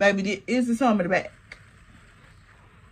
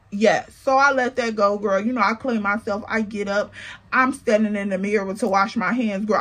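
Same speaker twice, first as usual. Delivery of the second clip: usual speaking voice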